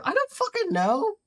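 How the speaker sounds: high panicked squeak; the voice cracks high in panic; character loses it trying to plan; panicked outburst. Acted. Character panics over next step.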